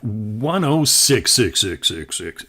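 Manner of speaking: repeats stepping down in pitch; imitated echo. Shown as a demonstration. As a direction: mimic an echo that sinks with each repeat. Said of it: "every repeat in the echo would drop down in pitch".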